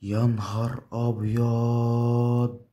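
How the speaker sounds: slow, high, loud stunned exclamation; amazed. Real, not acted. Streamer stunned by the win, slow amazed cry. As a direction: drag it out in disbelief.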